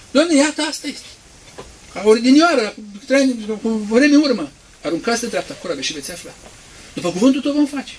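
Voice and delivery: high, loud, eager answer; eager disciple. Acted. Disciple answering the Lord, high and eager.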